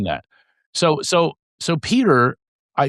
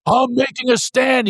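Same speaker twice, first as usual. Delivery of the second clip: high-pitched indignant protest, imitating a friend; acted-out defiant friend; defiant retort; 10 semitones higher than usual. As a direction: declare it stubbornly, pitched well up.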